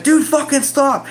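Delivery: high, loud pained yell; screamed protest. Retold or imitated. Patient begging the examiner to stop, screamed protest. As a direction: cry out for it to stop. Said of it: "I fucking screamed".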